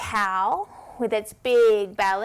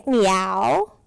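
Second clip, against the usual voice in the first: lower, playful cat meow; 4 semitones lower, 3 dB louder than usual. Shown as a demonstration. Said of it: "What sound does a cat make?".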